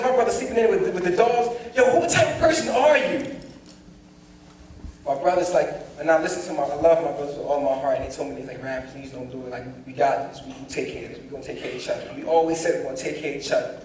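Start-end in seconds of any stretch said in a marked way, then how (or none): 1.7-3.4 high-pitched indignant question; outraged. Retold or imitated. Retold fight with the father, outraged. 8.6-12.1 low, soft, earnest appeal; gentle pleading. Retold or imitated. Brothers talking Rap out of it, gently pleading.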